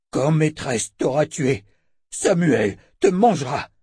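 slow, emphatic creature menace; hateful monster threat. A narrator's reading. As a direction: threaten with hatred, word by word. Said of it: "la haine fut aussitôt remplacée par de la terreur".

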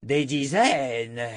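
drawn-out, mild dragon roar; dragon's roar. A narrator's reading. Dragon hearing of dozens of garnes, roaring. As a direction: stretch it slowly in disbelief. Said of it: "Écarlate rugit et cracha quelques flamèches".

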